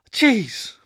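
high, loud burst of revulsion; repulsed exclamation; gagging disgust. A narrator's reading. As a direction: recoil from a foul smell. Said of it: "Blue gagged".

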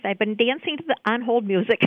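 usual speaking voice

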